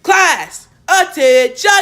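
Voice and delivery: loud, high-pitched shout calling the class to attention; barked command. Retold or imitated. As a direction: bark it out like a freshman playing officer. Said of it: "they would go".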